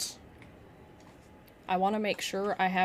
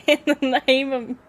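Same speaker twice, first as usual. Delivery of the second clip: loud, high, breaking into laughter; cracking up mid-whoop; laughing southern holler; 5 semitones higher, 9 dB louder than usual. Acted. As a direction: try to holler it through laughter. Cracking up while repeating it.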